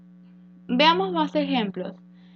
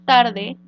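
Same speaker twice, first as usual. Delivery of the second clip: "tarde" with TAR stressed and louder; stress on first syllable; 8 dB louder than usual. Shown as a demonstration. Teacher starting an example for pupils, "tarde" stressed hard on TAR. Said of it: "donde tar".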